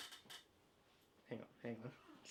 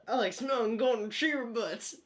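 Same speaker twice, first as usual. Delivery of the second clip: slow, slightly higher, louder cartoon hound; Huckleberry Hound impression; 5 semitones higher, 4 dB louder than usual. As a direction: lean into the lazy hound drawl. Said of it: "his best Huckleberry Hound impression".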